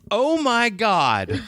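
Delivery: high and very slow oh my God; drawn-out exclamation. Retold or imitated. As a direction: stretch it out, embarrassed. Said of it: "out loud, I go".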